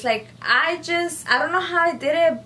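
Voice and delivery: amazed. Real, not acted. Still amazed at herself.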